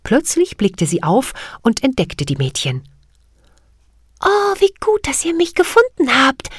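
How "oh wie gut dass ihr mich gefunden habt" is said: very high, clear little fairy voice; bell-like fairy voice. Acted. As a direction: ring the words out like a tiny bell. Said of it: "rief sie mit ihrer hellen Stimme, die wie ein Glöckchen klang".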